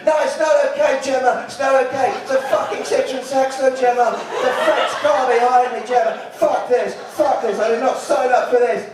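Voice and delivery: a horse losing it and yelling at Gemma; panicked horse. Acted. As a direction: horse shouting at rider Gemma about the car. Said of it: "the horse is going".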